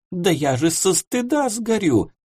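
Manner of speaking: blushing and protesting she'd die of shame; scandalised lady. Acted. Embarrassed client refusing the lingerie, flustered high voice.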